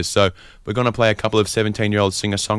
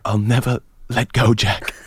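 lightly voiced Titanic farewell; 2 semitones higher than usual. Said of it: "what about just a little".

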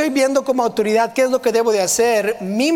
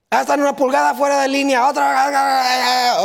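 higher, fast imitation of a nagging sergeant; mock sergeant yell; 4 semitones higher than usual. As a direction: rattle it off as a mock scolding. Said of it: "él nos gritaba por todo".

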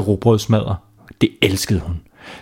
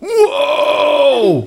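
excited shout; 14 semitones higher than usual. Acted. The boy getting his fishing rod back, shouting with joy. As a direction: burst out in sheer delight. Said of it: "råbte drengen".

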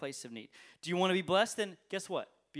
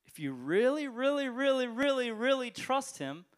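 "really" repeated, rising higher and louder, then slowing; building emphasis on really; 5 semitones higher, 4 dB louder, slower than usual. Real, not acted. Preacher urging total trust, escalating really. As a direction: climb on each "really", land slow on trust. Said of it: "building repeated really".

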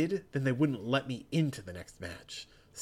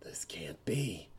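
slow, stunned whisper; 6 semitones lower, 8 dB quieter, slower than usual. Acted. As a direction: breathe it in disbelief. Stunned fighter disbelieving.

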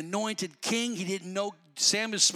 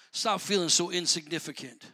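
ordinary talk

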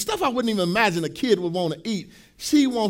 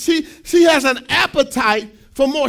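preaching shout; 3 semitones higher, 6 dB louder than usual. Real, not acted.

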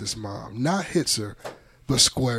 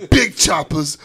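loud trash talk; 4 semitones higher, 6 dB louder, slower than usual. Pretend. Gamer trash talk, boasting.

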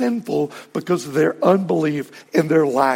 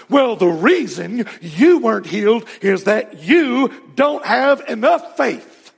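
put-on Southern accent; 4 semitones higher than usual. Faith healer blaming the unhealed in a Southern accent. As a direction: sell the blame in a Southern televangelist accent. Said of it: "I can do a good Southern accent".

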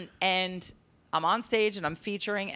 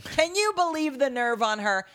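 higher-pitched mock outrage; mock indignation; 6 semitones higher than usual. Comic retelling her joke about a hated girl, mock indignant. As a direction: act petty and scandalised, pitched up.

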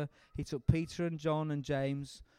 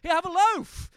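loud call; 13 semitones higher, 10 dB louder than usual. Real, not acted. Calling out while handing bread.

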